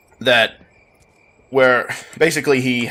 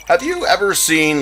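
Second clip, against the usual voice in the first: usual speaking voice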